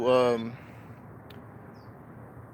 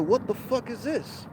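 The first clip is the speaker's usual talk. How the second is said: surprised; 8 semitones higher, 5 dB quieter than usual. Retold or imitated. Shocked by the voice.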